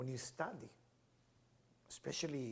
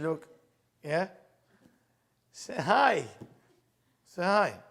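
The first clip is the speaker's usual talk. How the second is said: loud, high urging to say hi; urgent prompting; 4 semitones higher, 13 dB louder, slower than usual. Retold or imitated. Retelling the inner voice urging him, loud and high. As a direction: push yourself to speak up. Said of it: "retold inner voice prompting".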